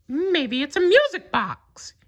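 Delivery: high falsetto mouse, wondering; curious Mickey. A narrator's reading. Mickey turning the key, high and curious. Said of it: "Mickey said".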